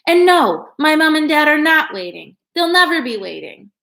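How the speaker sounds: high, loud, near tears; upset outburst. Pretend. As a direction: burst out, fighting tears. Girl's imagined outburst.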